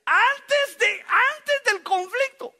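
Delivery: high, loud and slow; preaching emphasis. Real, not acted. Pastor stresses the lesson to the church, loud and slow. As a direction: drive the point home.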